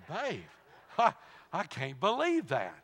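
retold amazed outburst with a laugh; mock disbelief. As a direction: exclaim it in playful disbelief, laughing partway.

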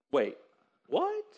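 high-pitched, rising disbelief; acted shocked squeak of a question; incredulous.

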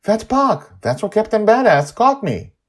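put-on high, eager girl's voice; a young girl thrilled at what she sees; excited child Joy. Joy spots the cloud city, high and thrilled.

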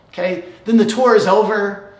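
ordinary delivery

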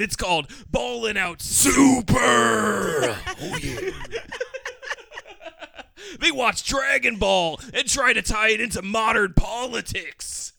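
shouted Hulk Hogan promo voice; wrestling promo. Acted. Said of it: "You gotta plug this like you're Macho Man".

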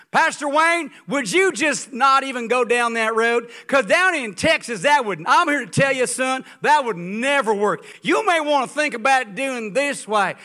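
loud, slow, brash Texan drawl; mock Texan interrupter. Preacher mimics the Texan cutting off Pastor Wayne, loud and brash. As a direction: boom it like a know-it-all Texan. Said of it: "he would be interrupting Pastor Wayne".

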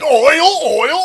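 yelling oil. Retold or imitated. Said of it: "that jackass just yelled oil".

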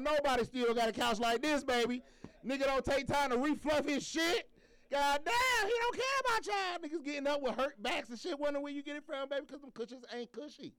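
roast the couch for the crowd; playful shouting rant about saggy cushions; hyped mock rant. Real, not acted. Host rants about the couch.

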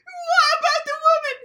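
overdone tearful whine; mock sob story. Nominee quoting his daughter in tears, hammed-up fake crying. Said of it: "the sob story to try corroborate".

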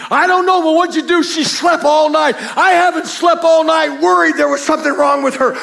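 high, loud, frantic outburst; loud retold pleading from the husband; worried shouting husband. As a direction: shout it like a husband up all night.

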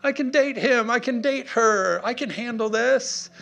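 very high-pitched mock delight; hammed-up gullible enthusiasm, loud; naively excited. Preacher voices people lured by the enemy's bait, naively excited. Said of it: "everybody's like".

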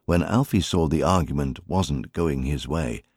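usual speaking voice

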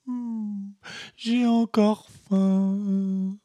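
whimpering. Acted. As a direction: whine it out like a hungry child. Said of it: "dit en pleurnichant".

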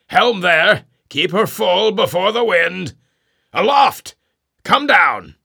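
high, loud commands across the deck; shouted orders. Acted. Ahab shouts orders to helm and lookouts, loud. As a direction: bark orders to the helm and mast.

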